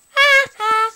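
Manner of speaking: high, fake two-beat laugh; put-on laugh; forced laugh. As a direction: try to laugh and fail. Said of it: "Oh, I can't laugh".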